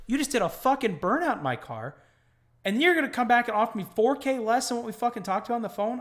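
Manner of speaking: loud, high, outraged rant; retold angry rant. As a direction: let the outrage pour out. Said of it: "I was like".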